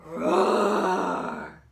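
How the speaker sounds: big loud roar; monster roar. Acted. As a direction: roar like a huge hairy beast. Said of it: "enormous hairy creature".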